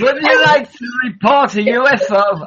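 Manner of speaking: badly faked French-Canadian accent. Emergency dispatcher in Canada taking a UFO report, attempted French-Canadian accent. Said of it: "I can't do that Canadian, uh, French accent".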